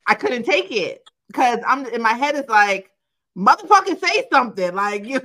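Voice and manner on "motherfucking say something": higher and louder, exasperated urging; will him to finally talk; impatient inner voice. Retold or imitated. [name] voicing her own thoughts at the guy, impatient and loud.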